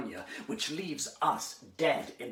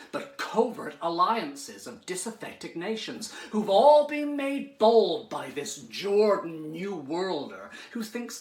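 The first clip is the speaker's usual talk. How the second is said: loud, higher political oratory; impassioned tirade; 4 semitones higher, 8 dB louder than usual. Acted. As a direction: hammer the warning home with rising force.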